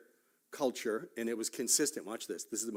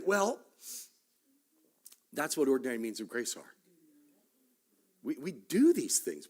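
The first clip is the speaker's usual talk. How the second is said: ordinary talk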